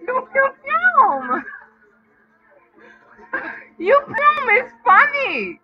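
high, slow laughing taunt; cracking up mid-taunt; squeaky giggling mockery. Real, not acted.

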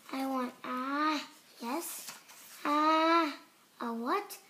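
ordinary delivery

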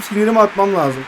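ordinary delivery

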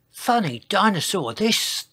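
lightly voiced puzzled dinosaur, pitched high; Sizo. A narrator's reading. Dinosaur wondering at a strange sight, lightly voiced high. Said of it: "thought Sizo".

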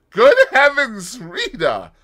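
high, loud shocked cry. Acted. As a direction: gasp it out in alarm. Shocked exclamation.

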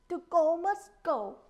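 declaring. A narrator's reading. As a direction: declare it firmly at full voice. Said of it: "he declared".

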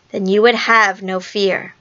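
ordinary delivery, nothing marked

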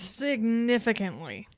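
"Significantly" stretched slowly for emphasis; drawn-out emphasis. Real, not acted. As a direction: echo "Significantly", drawing out every syllable. Echoes co-host's word for emphasis.

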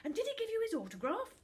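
fast, very high, loud question; breathless excitement. A narrator's reading. Charlie presses Finn about the footballer, breathless and eager. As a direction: rush it out eagerly. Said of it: "said Charlie breathlessly".

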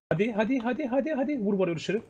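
high, rapid calls of hadi; excited urging. Real, not acted. Shot-caller urging his squad on, high and excited. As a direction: hurry them on in a high voice. Urging teammates mid-fight.

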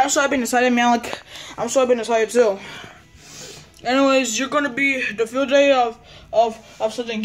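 ordinary delivery

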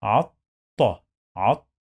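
عط as "at... ta", clear gap between the two; teacher splitting عط into "at-ta"; doubled ta. Shown as a demonstration. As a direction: sound the ط in عط twice. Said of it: "We pronounce طاء two times".

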